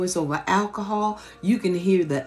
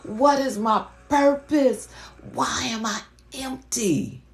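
slow, high voicing of an empty heart; mock inner cry; 7 semitones higher, slower than usual. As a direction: ask it slowly like a lost soul crying out.

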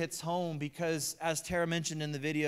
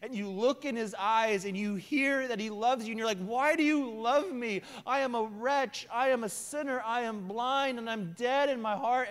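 loud, high preacher's climax; higher and louder, fervent sermon peak; preaching at full force; 6 semitones higher, 3 dB louder than usual. Real, not acted. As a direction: build it like an altar call.

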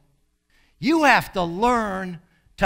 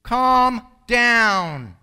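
Calm down said higher and louder, drawn out and firm; emphatic command; 5 semitones higher, 7 dB louder than usual. Shown as a demonstration. Preacher commanding a panicking congregation, loud and firm. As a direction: order them to calm down with force. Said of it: "if God's gonna use you".

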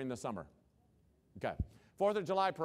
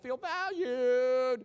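high, loud, stretched-out mock whine; hammed-up aggrieved whine; mock whiner; 10 semitones higher, 7 dB louder, much slower than usual. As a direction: wail the word like a pouter. Mimicking pouters who don't feel valued.

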